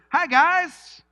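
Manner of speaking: high, loud, breezy hello; casual Jesus. Acted. Jesus surprising the disciples, joking casual greeting. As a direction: pop in like a buddy. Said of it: "Okay, actually".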